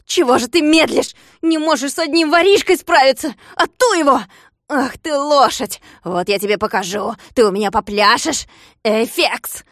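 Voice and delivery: loud, high-pitched scolding; angry yelling. Acted. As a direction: berate the useless dog. Klops berates dog and servant.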